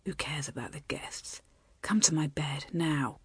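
low, hushed, coaxing whisper; seductive whisper. A narrator's reading. Husband nuzzling his wife, luring her to bed, seductive whisper. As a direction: whisper it low, tempting a lover.

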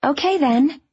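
high, determinedly upbeat; trying to sound upbeat. A narrator's reading. Mother ending a difficult call, trying to stay upbeat. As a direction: keep it positive, with effort. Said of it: "trying her best to keep it upbeat".

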